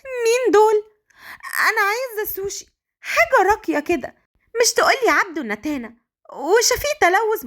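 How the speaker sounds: put-on high-pitched disbelieving girl's voice; Malika, baffled. A narrator's reading. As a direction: protest in high, incredulous tones. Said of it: "مليكة باستغراب". (باستغراب وصوت عالي الطبقة)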